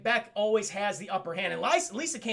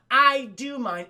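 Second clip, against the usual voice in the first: mock defiant; 5 semitones higher, 9 dB louder, slower than usual. Retold fight starts.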